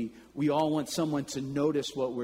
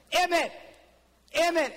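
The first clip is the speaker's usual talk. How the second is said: calling out; 13 semitones higher than usual. Retold or imitated. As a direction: shout for Emmett like a little boy up a tree. Boy calling again.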